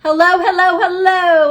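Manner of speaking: high, loud hellos; shouting. A narrator's reading. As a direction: shout with relief. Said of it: "I shouted".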